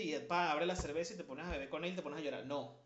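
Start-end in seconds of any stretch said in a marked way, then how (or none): none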